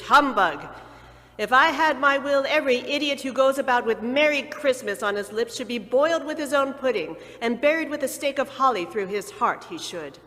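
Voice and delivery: loud, furious miser's tirade; old Scrooge ranting at his cheerful nephew; angry rant. A narrator's reading. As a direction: rail against Christmas with contempt. Scrooge ranting against Christmas.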